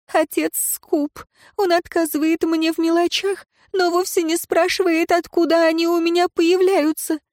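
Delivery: put-on high, pleading girl's voice; tearful young woman. A narrator's reading. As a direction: confess it through tears. Girl's voice confessing.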